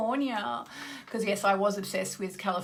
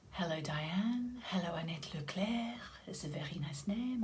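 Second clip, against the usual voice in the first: soft unvoiced whisper of greetings; hushed, playful greeting to a viewer; 2 semitones lower, 10 dB quieter than usual. Real, not acted. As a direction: breathe the names in a hush.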